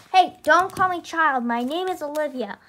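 sassy. Acted. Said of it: "You're very sassy".

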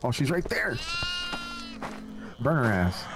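ordinary talk

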